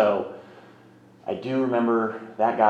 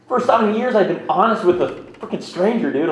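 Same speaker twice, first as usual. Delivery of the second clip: emotional burst; 10 semitones higher, 7 dB louder than usual. Real, not acted. Recalls breaking down in tears.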